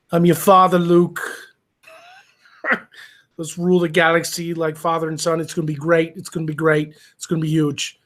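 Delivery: a bad Trump doing Star Wars; Trump as Vader. Host imagines Trump narrating Star Wars, as a hammy impression. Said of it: "Trump narrates Star Wars?".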